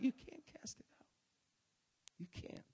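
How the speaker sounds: hushed unvoiced whisper. Real, not acted. Preacher to congregation, hushed whisper.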